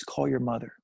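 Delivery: low-pitched voice from the corner; lightly voiced, low instruction from nowhere; mysterious voice. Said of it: "I listen to the voice one more time".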